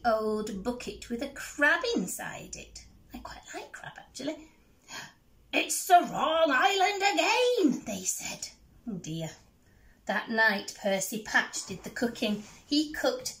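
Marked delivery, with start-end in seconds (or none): 5.5-7.8 high mock wail of dismay; disappointed pirates. Pirates groan to each other at the wrong island, mock wail. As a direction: whine it like a let-down pirate.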